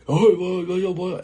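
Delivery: MC [name] hype. Retold or imitated. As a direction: call it out like an MC hyping a crowd. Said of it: "if you don't know who [name] is".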